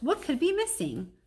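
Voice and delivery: high, exasperated dog voice; Bob's exasperated voice. Acted. Bob teasing the temperamental artist, high and exasperated. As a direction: toss the question up, exasperated. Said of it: "Bob groans".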